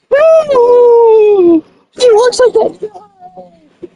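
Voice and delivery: loud, shocked No; loud outburst. Real, not acted. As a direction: burst out in shocked protest.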